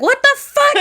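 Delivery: high, loud outburst of alarm; acted alarmed outburst; freaking out. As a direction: imitate a friend freaking out, pitch shooting up. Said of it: "And then Val freaks out".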